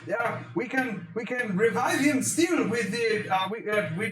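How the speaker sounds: very high, excitable underling voice; hammed-up high-pitched zealous servant; eager acolyte. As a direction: propose it in a high, fretful squeal.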